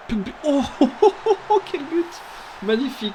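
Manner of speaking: thrilled shout with laughter; excited sports commentary. Real, not acted. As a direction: yell with delight at the goal. Goal scored in the game.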